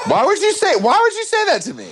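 squeaky high indignant voice; high-pitched. Real, not acted. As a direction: voice jumps way up in outrage. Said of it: "what level of high-pitched your voice would go up to".

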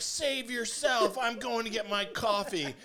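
high, slow, loud hammed-up mom; high loud mocking wife. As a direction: ham up the selfish mom, high and drawn. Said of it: "Jen was like".

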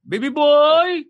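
put-on high, loud sing-song baby boy; mock doting parent calling a little son. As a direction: call out like a gushing parent. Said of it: "Kahit sa lalaki".

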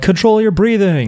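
imitated urging from the sidelines; mock coaching call. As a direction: call out advice like an onlooker, voice lifted. Two onlookers' shouted advice, retold.

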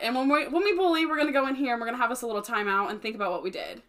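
normal talking voice

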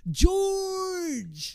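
high, loud, drawn-out holler; excited calling out. Retold or imitated. Fans spotting their favourite TV star, hollering his name. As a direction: yell it like fans hailing an old pal. Crowd calls out to familiar TV star.

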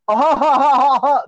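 high, evenly pulsed mock laughter; fake laugh track. Mocking a late-night show's laugh track, fake laughing. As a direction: imitate canned audience laughter. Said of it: "you can hear the fake laughs that they put".

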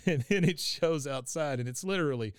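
ordinary talk, nothing marked

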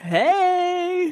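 high, long-held hey; mock intro greeting. Co-host acts out her part of intro, high drawn hey. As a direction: sing out the greeting. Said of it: "And you'll be like".

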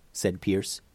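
plain reading voice

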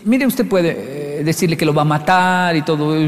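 usual speaking voice

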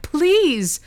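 high, loud desperate scream; screamed please. Acted. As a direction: scream after the child being taken. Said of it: "screamed Maeve".

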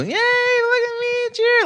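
high, slow, sugary fan-pleasing voice; mock cheery babyface. Wrestler mocking the crowd-pleaser role he hates, sing-song. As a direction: ham up a goody-goody hero begging for cheers.

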